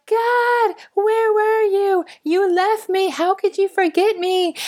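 high, drawn-out whine of blame; mock wail to God. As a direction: wail accusingly, hammed-up and very high. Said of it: "And then we're like".

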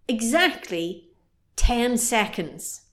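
a slow, weighty build-up to the punchline; slow and deliberate. Real, not acted. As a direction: spell out every word slowly. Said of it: "slowing to stress the time".

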